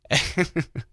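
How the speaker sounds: high giggle; real laughter; laughing. Real, not acted. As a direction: let a laugh out. Laughing at the story.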